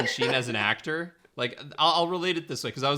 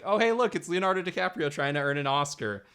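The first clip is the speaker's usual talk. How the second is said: mildly mocking excitement, high and loud at first; mock recognition; 6 semitones higher, 3 dB louder than usual. Viewer spotting an actor chasing an Oscar, mock excited. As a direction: pipe up as if spotting a star.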